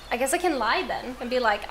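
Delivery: ordinary talk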